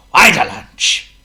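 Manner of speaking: slow, loud, marvelling repeat; amazed exclaim. A narrator's reading. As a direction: savour the words. Narrator repeats for emphasis.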